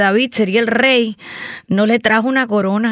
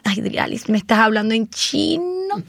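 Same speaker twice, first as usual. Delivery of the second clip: soft, lightly voiced baffled client; a hint of a lost customer; confused client; 4 dB quieter than usual. Client confused by the agent's jargon, mild voice.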